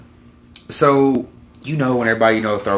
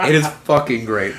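laughing through the words; real laughter. Real, not acted. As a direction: laugh while praising it. Laughing at own story.

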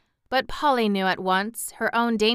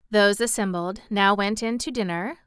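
ordinary delivery, nothing marked

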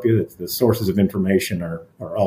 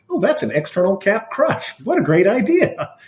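much higher-pitched mock amazement; retold delighted reaction; 10 semitones higher than usual. Colleague reacting to the speaker's technique, imitated excitement. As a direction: imitate an impressed colleague, voice lifted high.

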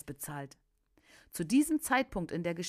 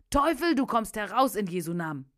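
loud, forceful order; exorcism command; 3 semitones higher, 7 dB louder than usual. A narrator's reading. Preacher orders the devil out, loud and commanding. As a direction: command it out with authority.

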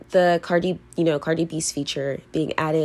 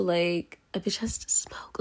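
whispered aside; 7 dB quieter than usual. Real, not acted. Owning up to viewers about needing to smoke, whispered. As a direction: drop to a soft confiding whisper. Confiding a guilty habit aside.

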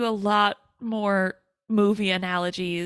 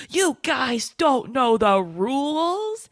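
slow, higher, drawn-out disbelief; incredulous movie nerd; 4 semitones higher, 3 dB louder, slower than usual. Retold or imitated. Movie nerd lecturing his friends on rules, incredulous. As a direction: stretch every word, aghast at their ignorance. Voices movie nerd's big moment.

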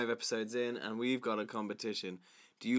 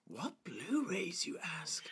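slow, hushed teaser; mock-mysterious whisper to the audience; stage whisper; 7 semitones higher, 7 dB quieter, slower than usual. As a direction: whisper it like a secret reveal. Mock dramatic aside.

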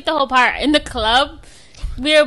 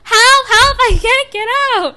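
higher, louder hammed-up shouts for help; mock cry for help; 12 semitones higher, 8 dB louder, slower than usual. Trapped in the bathroom, calling her cousin in a high shout. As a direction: yell for help like you're trapped. Banging on locked door.

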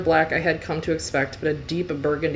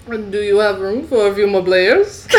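louder, higher, hammed-up French accent; 4 semitones higher, 7 dB louder than usual. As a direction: ask like a cartoon Frenchman. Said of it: "I'm picturing Lumiere from Beauty and the Beast".

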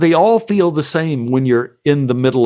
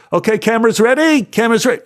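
much higher, called through a door; calling out cue; 10 semitones higher, faster than usual. Retold or imitated. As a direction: announce the camera is ready to the set. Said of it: "I hear the A D on the other side door".

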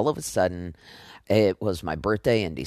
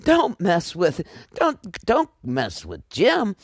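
excited; 9 semitones higher than usual. Real, not acted. Excited fan praising Captain Kirk.

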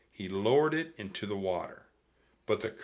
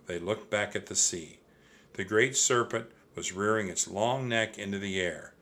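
plain reading voice

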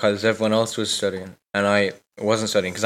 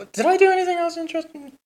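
squeaky voice; faster than usual. Pretend. Squeaky self question.

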